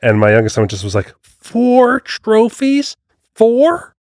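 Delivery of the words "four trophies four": high, slow astonished "Four trophies?"; stretched, high-pitched wonder; amazed kid. Retold or imitated. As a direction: mimic a kid's stunned disbelief. Son's amazed reaction retold.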